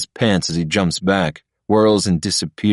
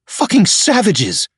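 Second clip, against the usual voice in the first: slow, much higher snarl of outrage; 4 dB louder, slower than usual. A narrator's reading. Patrick snarling at Misha and Ilya, outraged. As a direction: spit the insult out. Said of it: "he snarls".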